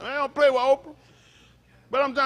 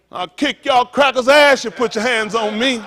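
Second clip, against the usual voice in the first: big booming authoritative preacher voice; authoritative Martin voice; 3 semitones higher, 8 dB louder than usual. Retold or imitated. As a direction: boom it out like Martin laying down the law. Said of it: "That's an authoritative voice like that".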